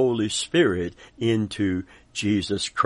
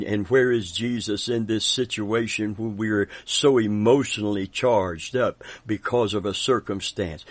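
ordinary talk

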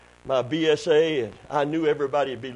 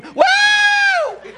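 loud, very high-pitched whooping scream; piercing high yelp, held long; retold scream; 10 dB louder than usual. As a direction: re-enact a shrieked "woo". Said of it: "I let out a scream".

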